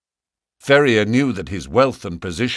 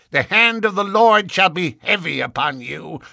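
cried threat; 9 semitones higher than usual. A narrator's reading. Young Drebber threatening Ferrier with the Lord. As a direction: call down judgment on him. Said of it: "cried young Drebber".

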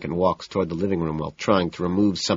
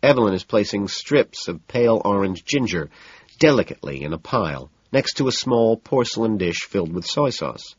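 ordinary delivery

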